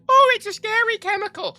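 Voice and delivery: high, loud, put-on frightened voice; mocking scared voice. Mocking people who fear MSG, high scared squeal. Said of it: "everyone's like".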